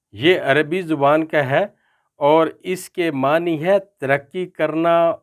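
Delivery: ordinary delivery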